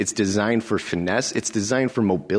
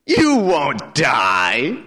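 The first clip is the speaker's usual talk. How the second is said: slow, louder and higher, sly hiss; hissing snake; 6 semitones higher, 5 dB louder, much slower than usual. Acted. Snake hissing to the woman, slow and louder. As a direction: draw out a tempting hiss. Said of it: "the snake hissed".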